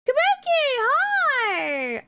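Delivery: high-pitched delighted squeal; excited greeting. Real, not acted. Streamer breaks off to greet Kabuki, high excited squeal.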